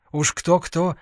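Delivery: plain reading voice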